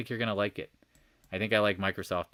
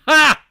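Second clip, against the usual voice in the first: one loud, high "Ha!"; sharp laugh; 19 dB louder than usual. Real, not acted. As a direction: burst out with a single laugh. Sudden loud laugh at chart.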